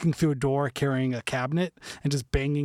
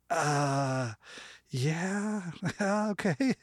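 put-on stoned kid; 3 semitones higher, 4 dB quieter, much slower than usual. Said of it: "sounds like the most stoned kid".

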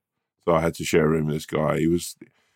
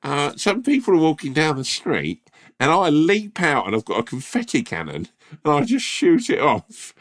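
nervous posh newbie; 9 semitones higher than usual. Pretend. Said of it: "And you're like".

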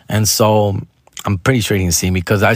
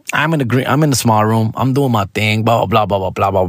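ordinary talk, nothing marked

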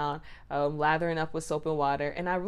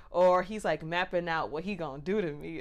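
usual speaking voice

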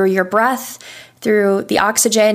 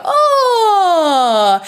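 yawning oh; 9 semitones higher than usual. Shown as a demonstration. Coach showing a relaxed waking yawn sound. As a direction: let a big yawn glide down. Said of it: "Everybody wakes up and yawns".

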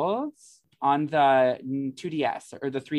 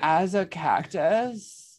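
mock disbelief; 4 semitones higher, much slower than usual. Playful mock disbelief at the boast.

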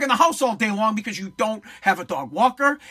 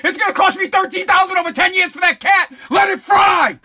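high, loud yell through laughter; laughing mock shout; 8 semitones higher, 6 dB louder than usual. Host jokes about what the saved cat costs, laughing mock shout. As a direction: holler it in joking outrage.

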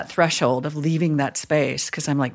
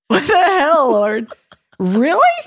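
higher and louder, indignant disbelief; exasperated cry to God; 10 semitones higher, 6 dB louder, slower than usual. Retold or imitated. Chaplain alone on hospital lawn, exasperated outcry to God. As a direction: throw the question up at the sky. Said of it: "walking out there and going".

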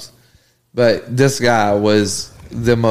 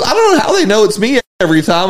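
puzzled guy voice; 9 semitones higher, faster than usual. Retold or imitated. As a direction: play the clueless trucker. Retold man puzzled, higher.

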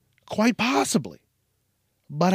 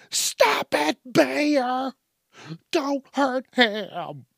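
high, stretched-out hysterical pleading; mock screaming bystander; 11 semitones higher, much slower than usual. Onlooker pleading with a bear, mock high wail. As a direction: mimic an onlooker wailing at an animal. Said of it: "I've seen videos where they're like".

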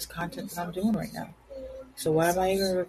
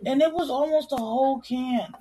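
high-pitched, upset lament; real dismay; 10 semitones higher than usual. Real, not acted. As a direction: complain in dismay over a spill. Lamenting the soda she just spilled.

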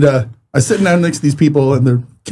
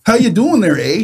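put-on Canadian accent; 7 semitones higher than usual. Mocking Canadians.

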